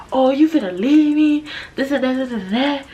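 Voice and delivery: hamming up his whiny blow-up; mock blow-up. Guy she's leaving blows up at her, high mock whine.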